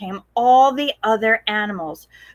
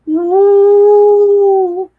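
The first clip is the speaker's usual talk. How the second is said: loud high ghostly hoot; a spooky ghost wail; ghostly woo; 10 semitones higher, 9 dB louder than usual. Acted. Strange noise from the field, loud ghostly woo.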